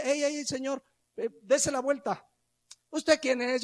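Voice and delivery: high, firm guard telling a shopper to turn around; a hint of a mall cop scolding; stern store guard. Guard stops rule-breaking parent.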